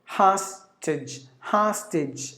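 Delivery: "hostage" with open AH, HAHS-tij; American HAHS-tij for "hostage"; American pronunciation. Shown as a demonstration. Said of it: "If you go to America".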